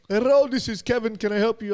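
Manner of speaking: phone agent voice. Retold or imitated. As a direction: put on the sing-song phone greeting.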